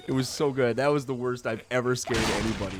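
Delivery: normal talking voice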